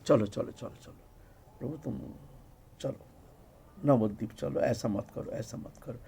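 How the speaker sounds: very soft, low acted plea, fading almost to nothing; gentle coaxing. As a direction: coax him softly to come away. Acted gentle coaxing after the weeping.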